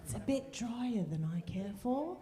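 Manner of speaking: a hint of a dry British critic; mock British judge. Wife mimicking husband judging her banana bread, light British accent.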